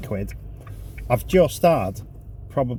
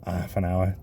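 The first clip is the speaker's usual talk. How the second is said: ordinary talk, nothing marked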